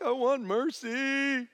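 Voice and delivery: put-on high, slow, begging child; pleading kid voice. Dad voicing his son begging for mercy. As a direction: beg for mercy. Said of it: "they're like".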